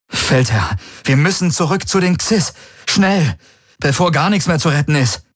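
high, pressing appeal; urgent plea. A narrator's reading. Urgent plea to commander in battle. (eindringliches, hohes Drängen)

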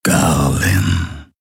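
low, drawn-out growled name; deep rumble. A narrator's reading. A deep apparition voice summons Garland, rumbling. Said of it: "brummte erneut eine tiefe Stimme".

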